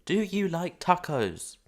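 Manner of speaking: very loud, high, slow question; insistent calling out. Real, not acted. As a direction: hammer out each word loudly and slowly. Repeats the question to Nova, louder.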